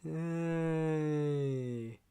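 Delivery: dry sarcastic yay. Acted. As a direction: fake cheer for a chore. Sarcastic "my favourite thing.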